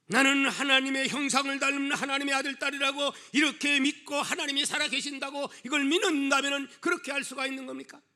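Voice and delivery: full-force preaching. Real, not acted. Preacher challenging believers who claim God lives, full-force preaching. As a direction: press the rhetorical question on the congregation.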